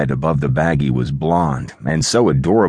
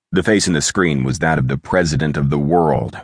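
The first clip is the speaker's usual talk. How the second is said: ordinary delivery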